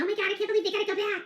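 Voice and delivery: high, fast mock whine of the crowd; mocking exasperation. Creator mimics disappointed crowd, high fast mocking whine. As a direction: rattle it off like a mocked gossip. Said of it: "everybody saying".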